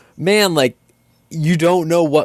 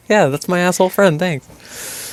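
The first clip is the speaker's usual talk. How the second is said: higher sarcastic mock voice; mock player; 7 semitones higher than usual. Thanking Rover in a higher mock voice. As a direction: play the cheerful player, tongue in cheek.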